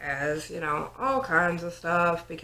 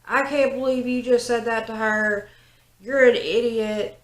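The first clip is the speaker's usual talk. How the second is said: angry; 5 semitones higher than usual. Retold or imitated. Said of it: "instantly pissed off".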